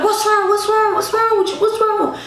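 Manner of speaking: high-pitched, insistent questioning; retold urgent concern. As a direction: act out an alarmed friend on the phone. Friend reacting to her crying call.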